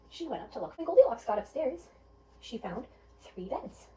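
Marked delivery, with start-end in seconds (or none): none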